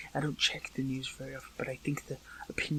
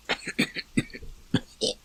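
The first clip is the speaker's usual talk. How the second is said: loud, repeated coughs; hard coughing fit; 4 dB louder than usual. Acted. Freeing water held in the throat, hard coughing.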